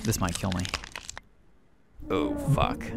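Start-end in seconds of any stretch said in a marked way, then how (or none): 2.1-2.8 sudden very high squeak; panicked yelp. Real, not acted. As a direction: voice cracks up in fright. Sudden danger, voice jumps.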